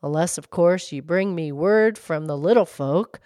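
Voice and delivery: low-pitched fish, lightly gurgled; gurgling fish. A narrator's reading. Fish setting the girl a condition, low gurgling voice. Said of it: "gurgled the fish".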